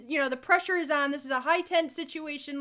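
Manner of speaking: ordinary talk, nothing marked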